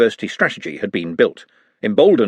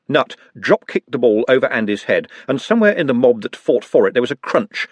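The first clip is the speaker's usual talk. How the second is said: usual speaking voice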